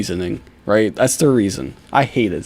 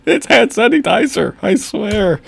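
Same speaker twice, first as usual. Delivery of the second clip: much higher, louder whiny imitation; squeaky mock excuse; 10 semitones higher, 5 dB louder than usual. Vlogger mimicking the suspect's lie in a squeaky voice. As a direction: squeak out his excuse mockingly.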